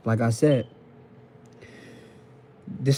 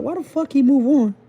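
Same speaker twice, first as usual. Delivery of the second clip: mock outraged confusion; 12 semitones higher than usual. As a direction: play the baffled ex, voice jumping high in disbelief. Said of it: "Y'all be like".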